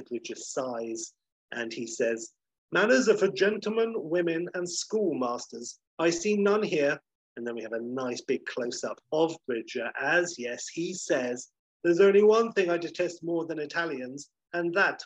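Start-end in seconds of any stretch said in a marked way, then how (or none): 2.7-7.0 higher, louder mock-regal scorn; haughty rebuke. Unimpressed Bridger rebukes Delon. 11.8-15.0 higher, lightly acted regal scorn; haughty disdain. Bridger declares his disdain for French.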